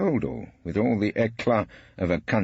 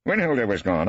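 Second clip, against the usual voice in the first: usual speaking voice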